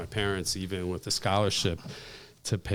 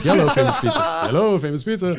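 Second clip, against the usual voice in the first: high, loud "Yellow, Famous Pizza" with the H dropped from "Hello"; dad answering phone; 10 semitones higher, 9 dB louder, faster than usual. Retold or imitated. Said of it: "my dad, when he answered the phone".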